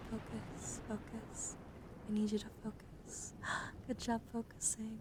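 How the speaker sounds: ordinary delivery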